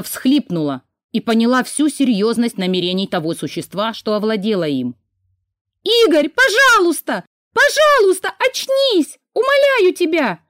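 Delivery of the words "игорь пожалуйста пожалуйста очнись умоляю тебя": high, loud begging cry; desperate pleading scream. A narrator's reading. Girl begging her possessed brother to wake up, screaming. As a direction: plead with him at the top of her voice. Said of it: "Уже громче закричала я".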